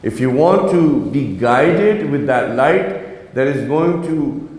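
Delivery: ordinary talk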